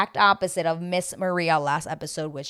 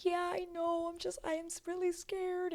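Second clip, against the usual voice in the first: high, soft teary voice; mock crying daughter, small and high; imitating crying; 13 semitones higher, 8 dB quieter than usual. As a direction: whimper it like a scared girl about to cry. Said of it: "just crying".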